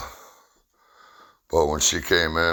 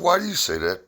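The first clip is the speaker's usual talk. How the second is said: exasperated question; faster than usual. Retold or imitated. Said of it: "I said".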